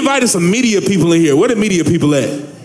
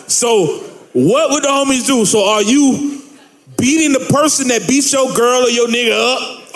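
normal talking voice

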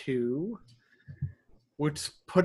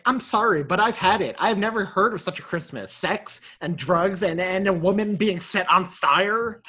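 snapping; 4 semitones higher, 6 dB louder than usual. Retold or imitated. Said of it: "Connie snaps at one point".

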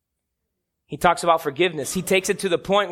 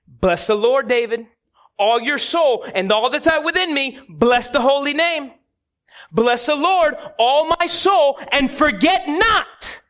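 raised, higher-pitched self-exhortation; David exhorting himself; 6 semitones higher than usual. Retold or imitated. David talking to himself in the mirror, high emphatic exhortation. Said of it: "I can picture David looking at the mirror".

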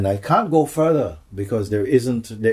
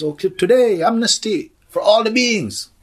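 high, louder proclamation; announcing voice; 13 semitones higher, 6 dB louder than usual. Retold or imitated. The voice announces amnesty, high proclamation. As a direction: proclaim it like a herald. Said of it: "the voice comes and says".

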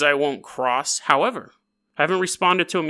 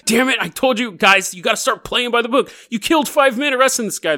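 mock angry sergeant; 7 semitones higher, 3 dB louder than usual. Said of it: "Sergeant has a big old mustache".

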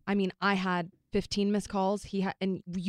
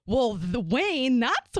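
slow and a bit louder, knowing tone; imitating Janet; 4 semitones higher, 4 dB louder, much slower than usual. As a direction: drag it out like a proud mum explaining. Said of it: "And she goes".

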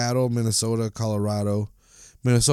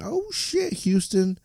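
a sudden, much higher yelp of realization; surprised outburst; 10 semitones higher, 3 dB quieter, slower than usual. Real, not acted. Podcaster realizing the next game is Houston, surprised yelp. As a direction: caught off guard by the fixture.

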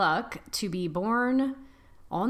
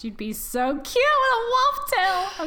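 high, squealy delighted; gushing; 13 semitones higher, 5 dB louder than usual. Real, not acted. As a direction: gush over something adorable. Gushing over cute wolf tail.

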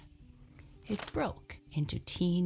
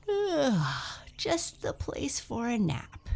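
sleepy yawn; 7 semitones higher, slower than usual. Acted. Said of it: "yawned Goldilocks sleepily".

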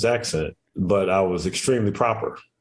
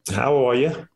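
lightly attempted English accent, pitched higher; 4 semitones higher than usual. Shown as a demonstration. Guest obliging the host's request, a slight English accent. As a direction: have a half-hearted go at an English accent. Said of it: "let me hear a little bit of your English accent".

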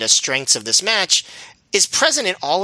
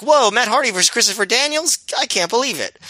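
higher, hammed-up announcer amazement; mock excited announcers; 8 semitones higher than usual. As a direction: play the fake-shocked commentator. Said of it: "this time they're like".

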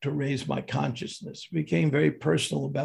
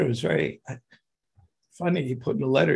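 ordinary delivery

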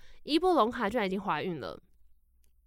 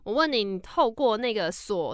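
normal talking voice

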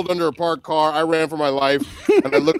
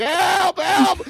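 mock panicked cry for help; slower than usual. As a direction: scream for help like you're trapped. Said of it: "We're like".

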